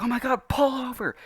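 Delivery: high, frantic imitation of mom; panicking mom. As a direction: shriek it like a panicked mother. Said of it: "My mom is, like, panicking".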